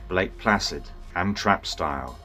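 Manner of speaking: plain reading voice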